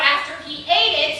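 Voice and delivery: usual speaking voice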